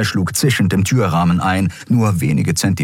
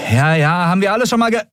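high, dismissive shout cut short; jeering retort; 11 semitones higher, faster than usual. A narrator's reading. Andriel brushing off threats until grabbed, jeering shout. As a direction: yell back, fed up.

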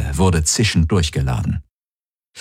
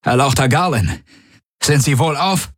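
higher, formal creature voice; guard checks on the lord; alien guard voice; 8 semitones higher than usual. A narrator's reading. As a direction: ask with stiff concern. Alien guard addresses the Krondar.

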